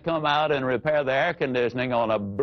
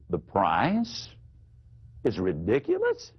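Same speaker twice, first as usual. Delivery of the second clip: much lower voice, ending on a rise; lowered voice, rising question; 8 semitones lower than usual. Shown as a demonstration. Salesman repeating the objection, low voice with a rising end. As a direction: calmly hand the objection back as a question. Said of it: "You lower your voice".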